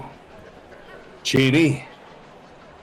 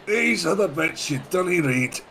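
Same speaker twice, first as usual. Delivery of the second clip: gruff adventurer voice; 10 semitones higher, slower than usual. Acted. Player voices his character arriving.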